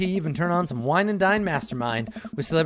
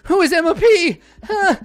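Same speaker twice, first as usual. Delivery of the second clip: frantic panic; 14 semitones higher, 9 dB louder than usual. Acted. A worried dad panics about his daughter's friends, rising into a high, loud squeal. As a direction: voice shooting way up and louder in panic.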